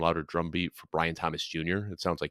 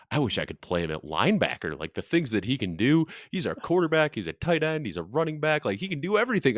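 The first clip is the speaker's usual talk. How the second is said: higher and higher excited gushing; 6 semitones higher than usual. Retold or imitated. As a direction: rave like a proud coach. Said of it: "just gushing about Taysom Hill".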